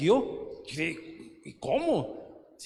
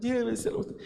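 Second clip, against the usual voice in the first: imitating tearful plea; 8 semitones higher, 3 dB quieter than usual. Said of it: "se pone a llorar".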